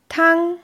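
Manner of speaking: Tang held level and high; first tone. Shown as a demonstration. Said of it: "gleichbleibend hochgesprochen". (Tang im ersten Ton, hoch und gleichbleibend)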